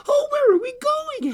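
put-on very high, loud child's question; little girl asking. A narrator's reading. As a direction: squeal the question like a small girl. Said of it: "asked the little girl".

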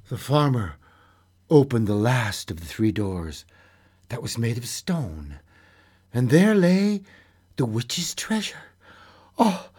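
lively storyteller voice, acting out the tale; story narration. A narrator's reading. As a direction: read it like a bedtime fairy tale. Storyteller reading a fairy tale.

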